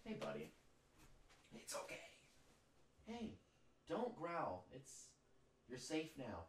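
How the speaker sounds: high, very soft soothing coo; gently calm a frightened pup; soothing the dog. Real, not acted. To his rescue dog mid night terror, a high soft coo.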